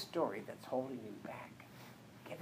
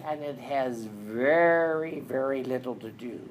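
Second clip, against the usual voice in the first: booming emphasis; 10 dB louder than usual. Real, not acted.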